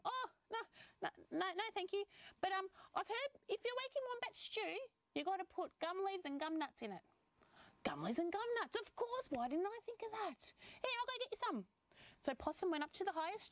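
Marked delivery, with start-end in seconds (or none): 0.0-7.0 high-pitched possum character voice; politely decline, then advise in a high voice; high, chatty animal helper; helpful possum. Acted. Plays the possum advising dingo. 7.8-11.7 high, animated character voice; high-pitched, eager animal; delighted storybook animal. Acted. Plays dingo and possum exchange.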